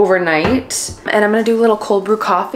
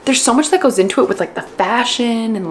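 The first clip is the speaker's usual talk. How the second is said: usual speaking voice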